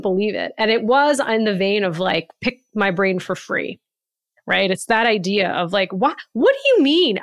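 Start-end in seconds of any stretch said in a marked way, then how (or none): 5.9-7.2 a higher, louder, shocked "what do you mean"; a retold gasp of disbelief; horrified disbelief. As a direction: replay the shock of reading that email. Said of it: "I was horrified".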